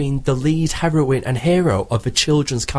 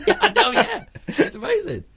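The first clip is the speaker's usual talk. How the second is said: laughing. Real, not acted. Laughing at Disney irony.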